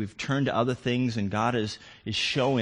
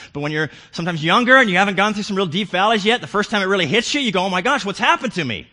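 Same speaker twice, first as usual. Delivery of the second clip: loud, high, driving sermon climax; preaching at full force; 11 semitones higher, 13 dB louder than usual. Acted. Preacher urging his congregation to seek God, full-voiced. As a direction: preach it with rising fervor.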